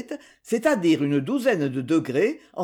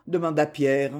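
ordinary delivery

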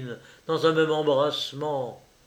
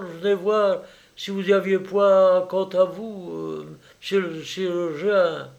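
higher, halting country woman's voice; a timid old peasant pleading; rustic peasant woman; 6 semitones higher, 4 dB louder than usual. Acted. Old peasant mother asking after her sick son, rustic timid voice.

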